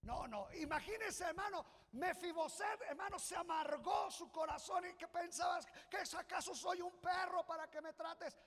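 high, loud preaching shout; fiery preaching. Acted. Preacher crying out to the congregation at full force. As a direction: preach it hard, rising to a cry.

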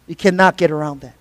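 hushed echo stressing "cannot"; quiet repeat. Shown as a demonstration. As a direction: drop to a low, soft echo of the line.

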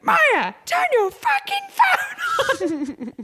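very high, drawn-out retold yell; mock shout. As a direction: holler for a missing friend, hammed up. Retold shouting across the party.

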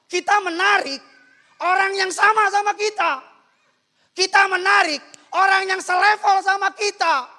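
very loud, high-pitched preaching; full-force preaching. Acted. Preacher tells congregation like attracts like, high shouted preaching. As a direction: drive each phrase home at a shout.

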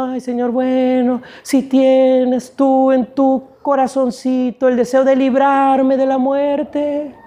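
slow, drawn-out mock pleading; mock timid prayer. Preacher parodying how Jesus did not pray, slow and mock-meek. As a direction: draw it out as a mock meek request. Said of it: "no le estaba diciendo".

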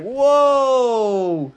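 long, high, loud stunned whoa. Retold or imitated. Vlogger imitates being blasted, stretched stunned whoa. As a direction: let the whoa stretch out in shock. Hit by woke napalm, stunned.

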